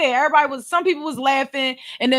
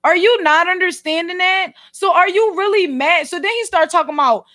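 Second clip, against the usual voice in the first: ordinary talk, nothing marked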